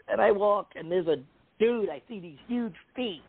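ordinary talk

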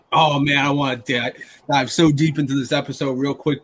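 ordinary talk, nothing marked

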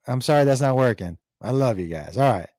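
usual speaking voice

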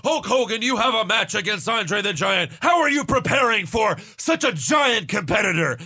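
high, loud announcer shout; hyped-up backstage interviewer; Mean Gene Okerlund. Retold or imitated. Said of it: "Mean Gene would be like".

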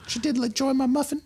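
high, folksy farmer voice; farm parent. Acted. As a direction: gush about the neighbour. Farmer voice in role-play.

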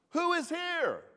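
high, ringing call through the house; shouted greeting. Retold or imitated. Grandfather calling to family on entering his home, shouted. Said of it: "I shouted out".

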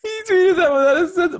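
high-pitched, talking through laughter; laughing. Real, not acted. Reacting to a Skip Bayless tweet, laughing. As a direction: squeeze the words out while cracking up. Cracking up at tweet just read.

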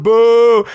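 loud, long high boo; long mock boo; imitated crowd booing. Crowd at standing dancers, imitated booing. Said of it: "they're, like, going".

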